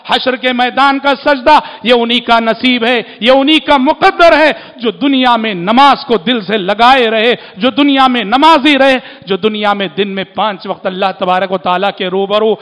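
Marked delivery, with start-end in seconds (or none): 0.1-8.9 louder and higher, fiery preacher's cry; preaching at full force. Acted. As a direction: thunder it out to the congregation. Sermon promising prostration to the faithful.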